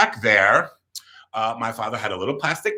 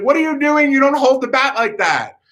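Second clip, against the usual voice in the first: high, strained mock yelling; temperamental coach; 12 semitones higher, 4 dB louder than usual. Coach correcting the kid's batting stance, temperamental yelling. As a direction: scold in a high, imitated coach yell. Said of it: "temperamental director".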